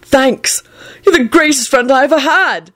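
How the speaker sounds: high, eager proclamation; loud proclamation. A narrator's reading. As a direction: announce it to the world. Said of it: "he proclaimed loudly".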